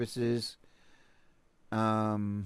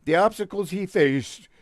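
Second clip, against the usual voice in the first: mock dramatic; 4 semitones higher, 3 dB louder than usual. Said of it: "I can't read this".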